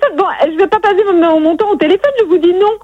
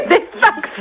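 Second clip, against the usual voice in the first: laughing; 6 semitones higher, 3 dB quieter than usual. Real, not acted. Laughs at absurd offer.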